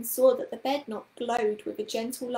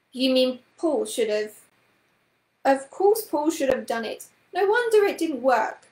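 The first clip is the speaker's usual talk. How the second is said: exasperated; 3 semitones higher, 8 dB louder than usual. A narrator's reading. Miss Price scolding Carrie for doing the spell, exasperated and loud. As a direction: scold the children, louder and louder.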